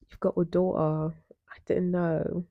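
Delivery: slow, put-on British accent. Shown as a demonstration. Izzy tells Jace about the daughter, slow British accent. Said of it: "I gotta say this in a British accent".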